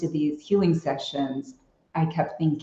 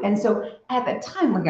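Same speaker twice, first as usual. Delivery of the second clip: ordinary talk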